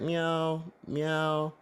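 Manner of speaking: high mewing cat imitation; high-pitched mimicked cat meow; imitated cat meow. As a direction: meow like a cat through the wall. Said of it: "and he hears, like".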